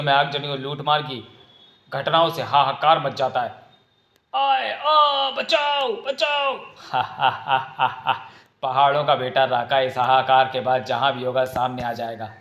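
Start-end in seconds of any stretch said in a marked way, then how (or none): 4.3-6.6 high panicked cries for help; terrified screams for help. Acted. Villagers attacked, crying for help. 6.9-8.2 evil laugh. Acted. Attacker laughs after raid.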